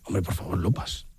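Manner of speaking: very low, soft retold reproach; hushed reproach. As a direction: imitate a discreet, embarrassed plea. Old friend, embarrassed, hushes the greeting.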